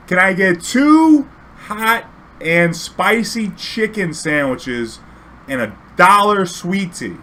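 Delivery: very slow, loud, high, every word stretched; exaggerated over-enunciation to a foreigner. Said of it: "talk a little louder or a little slower".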